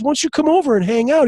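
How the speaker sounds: high, fast, friendly invite; eager retold invitation. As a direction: imitate a buddy inviting someone over. Retells Kevin Hart's invitation.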